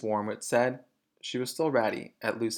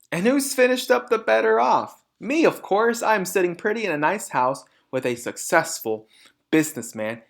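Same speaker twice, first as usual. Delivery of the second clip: high, loud boastful mother voice; gloating mother; 6 semitones higher, 5 dB louder than usual. A narrator's reading. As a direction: crow over her success. Mrs Wormwood gloating.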